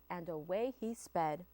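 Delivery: ordinary delivery, nothing marked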